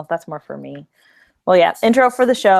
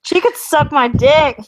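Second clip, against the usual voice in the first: higher, louder, slow and brash; defiant; 8 semitones higher, 6 dB louder, slower than usual. Acted. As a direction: fire it back, cheeky. Defiant joke retort.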